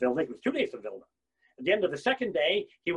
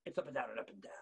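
soft, lower whisper; 8 semitones lower, 12 dB quieter, faster than usual. Real, not acted. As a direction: breathe it to them. Soft repeat.

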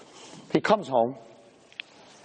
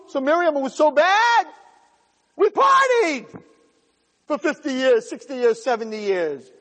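brash tempter; 10 semitones higher than usual. Acted. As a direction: brush off the complaint like a party friend. Yetzer Hara answers the soul.

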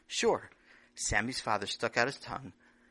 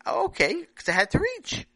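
put-on high child voice; 7 dB louder than usual. Sammy pleading to father.

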